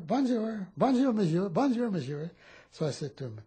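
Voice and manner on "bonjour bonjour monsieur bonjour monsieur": put-on higher, a bit louder boyish bonjour; lightly voiced French boy calling out; little French boy greeting the GIs, higher; eager kid greeting.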